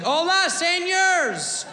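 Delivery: high, slow, hammed-up fake Spanish greeting; mock a tourist's overdone Spanish; bad Spanish accent. Brad greeting the restaurant, hammed-up high fake Spanish. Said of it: "talking Mexican, like".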